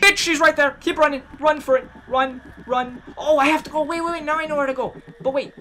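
high, loud frantic shouts; panicked yelling. Real, not acted. Gamer fleeing the monster, frantic shouting. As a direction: yell while running for your life.

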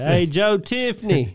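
imitated last-ditch shout-out; calling out. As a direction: call the name out slowly, like hailing someone. Quoting Hank's last-ditch call.

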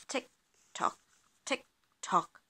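short, evenly spaced ticks and tocks; ticking clock. A narrator's reading. Living room clock ticking at night, imitated. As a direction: tick like a clock, with gaps between.

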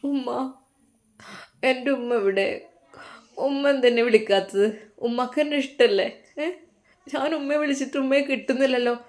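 a son close to tears on the phone; crying son. A narrator's reading. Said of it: "Shammas കരഞ്ഞുകൊണ്ടായിരുന്നു അത് പറഞ്ഞത്". (കരഞ്ഞുകൊണ്ട്, പതുക്കെ യാചിക്കുന്ന പോലെ)

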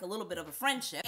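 ordinary talk, nothing marked

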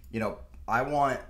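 ordinary talk

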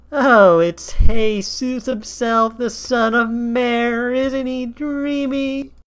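very high, loud, slow gushing; swooning fan. Acted. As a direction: gush over a crush like a starstruck fan. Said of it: "A Pegasus turned to her and said".

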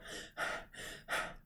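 short, anxious puffs of breath; breathless sigh. Shown as a demonstration. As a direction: puff as if just stopped running for a bus. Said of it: "but it's that".

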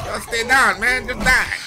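yelling at enemies. Real, not acted. Yelling at enemies while fighting.

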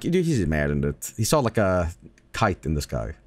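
low, offhand brush-off; dismissive skeptic. Retold or imitated. Skeptic explaining away a kid's sighting, dismissive.

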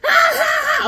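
very high, loud shrieks; startled shriek. Retold or imitated. Girls shriek at his drawn-on mustache, high and loud. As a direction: shriek at the sight. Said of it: "they're like".

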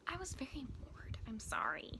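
soft sheepish whisper; whispered apology. Real, not acted. Apologizing to fans of the book, softly whispered. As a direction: whisper it like a guilty secret.

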